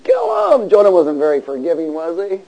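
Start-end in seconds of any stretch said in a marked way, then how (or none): 0.0-0.7 high, loud mock shout; hammed-up, shrieked command. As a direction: squeal the order like a vengeful cartoon character. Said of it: "Jonah was all".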